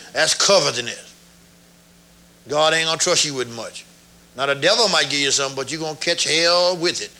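usual speaking voice

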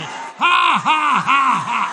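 big high forced laugh, falling; deliberate laugh. Shown as a demonstration. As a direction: demonstrate a booming preacher's laugh. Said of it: "Just laugh on purpose".

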